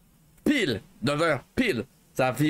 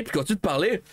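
usual speaking voice

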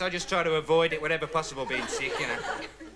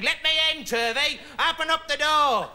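bang on the door and call out; 8 semitones higher than usual. Retold or imitated. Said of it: "And his voice saying".

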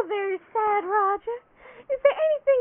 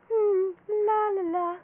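ordinary talk, nothing marked

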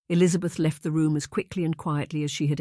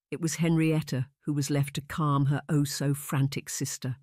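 ordinary delivery, nothing marked

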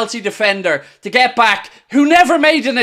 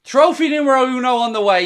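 normal talking voice

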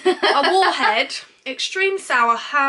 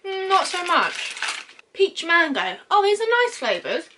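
chewing while talking; talking around sweets; mouth full of candy; 4 semitones higher, faster than usual. Real, not acted. Seen 0: Left speaker handles a candy packet, chews and grimaces through speech.

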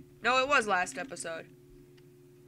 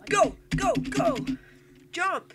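high, urgent calls; urging on; 10 semitones higher, 4 dB louder than usual. Real, not acted. Player urging his character to jump, high urgent shouts. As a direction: spur the character on.

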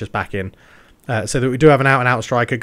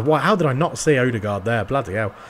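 usual speaking voice

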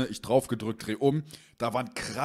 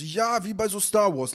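insisting friend; 9 semitones higher, 4 dB louder than usual. Retold or imitated. Friend defending his story to the owner, high and pleading.

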